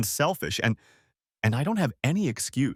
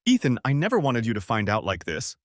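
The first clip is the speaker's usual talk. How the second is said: usual speaking voice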